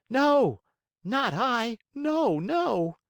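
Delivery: put-on very high, loud protest in a boy's voice; bitter sobbing. A narrator's reading. Pinocchio denies stealing the farmer's chickens, acted sobbing. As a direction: wail the denial high, acting tearful. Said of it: "sobbing bitterly".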